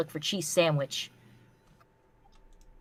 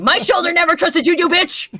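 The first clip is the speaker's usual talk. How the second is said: much higher, loud hammed-up yell; mock furious yell; 11 semitones higher, 8 dB louder than usual. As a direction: scream the typo with fake rage.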